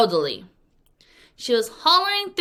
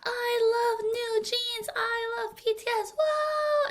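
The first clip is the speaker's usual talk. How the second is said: much higher, excited fangirl cheer; concert fan screaming; slower than usual. Retold or imitated. Fan at a New Jeans concert, high excited cheer. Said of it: "So maybe you're at a music concert".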